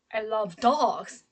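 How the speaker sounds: "dogs" held long at the end; stress on DOGS. Shown as a demonstration. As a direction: save the weight for "dogs". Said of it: "because my stress is on different word".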